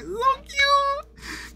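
tiny, very high soft squeal; gushing squeal. Real, not acted. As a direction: squeak it softly, overcome by cuteness. Gushing over the pet mouse.

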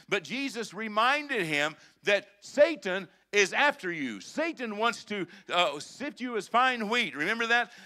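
loud, high, driving sermon voice; full-throated pulpit preaching; preaching at full force. Acted. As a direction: fire up the congregation.

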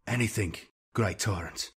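ordinary delivery

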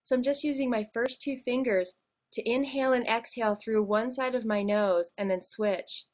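ordinary talk

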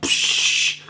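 hissy burst "psh"; exploding noise. Shown as a demonstration. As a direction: imitate a sausage bursting on the grill. Imitates sausage exploding.

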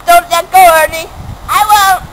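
ordinary delivery

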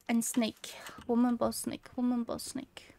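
whispered to self. Real, not acted. Gamer whispering the puzzle symbols to remember them. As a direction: whisper the list to memorise it.

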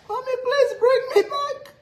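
very high, laughing mock sob; imitated crying child. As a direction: beg Mommy in a squeaky wail. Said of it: "If you see cry".

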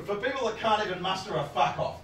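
ordinary talk, nothing marked

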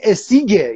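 "اسیگه" with a hard g; corrected "Essig" with g. Shown as a demonstration. Another commenter correcting the host, "اسیگه" with g. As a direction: say "اسیگه", g where others say k.

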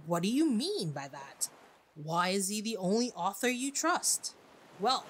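ordinary talk

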